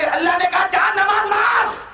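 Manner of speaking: very high, ringing challenge to the crowd; full-force preaching. Acted. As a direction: dare them to agree, last words held high. (ऊँची, ललकारती तक़रीर)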